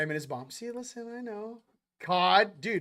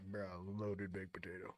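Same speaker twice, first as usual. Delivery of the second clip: much lower, laid-back bro voice; deep casual bro voice; 10 semitones lower, 15 dB quieter than usual. Retold or imitated. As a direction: drop the voice low and casual, like it's obvious. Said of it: "I'm like".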